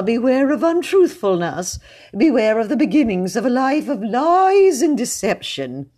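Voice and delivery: loud and lofty, pitched well up; pompous high-voiced preacher. A narrator's reading. Mr Jones lectures pale William about lies, high and pompous. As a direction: warn the boy, high and sanctimonious.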